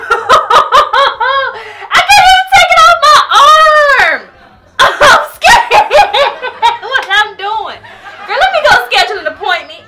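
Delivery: high, very loud wail breaking into laughter; panicked laughing wail. Real, not acted. Vlogger with wax stuck on her arm, laughing panic. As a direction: wail helplessly through laughter.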